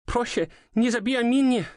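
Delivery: high, pleading cry in Polish; sobbing plea. A narrator's reading. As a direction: beg through sobs. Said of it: "She was sobbing, trembling".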